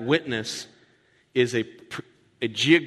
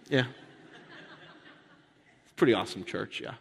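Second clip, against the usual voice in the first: ordinary delivery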